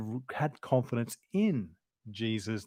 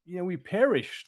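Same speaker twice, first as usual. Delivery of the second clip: crying out; 5 semitones higher than usual. A narrator's reading. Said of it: "they cried".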